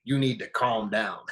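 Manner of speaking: lightly imitated, talking down to an angry person; mock soothing order, a touch condescending; patronizing calm down. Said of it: "started off a conversation with someone who's angry".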